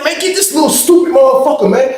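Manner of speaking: high-pitched acted exasperation; retold plea to get his cellmate off; exasperated demand.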